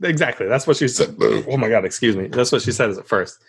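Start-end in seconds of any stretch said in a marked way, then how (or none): none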